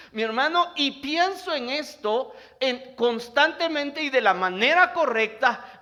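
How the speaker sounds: impassioned Spanish sermon; fervent preaching. Acted. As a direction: preach it out, loud and driving. Preacher at full force.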